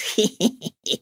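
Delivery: put-on soft sly old man giggle; old man's giggle. A narrator's reading. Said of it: "хихикнул старикашка".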